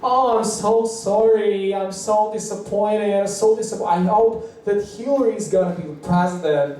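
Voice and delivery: imitated weepy wail. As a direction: whine a tearful apology, hammed up. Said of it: "[name] are crying".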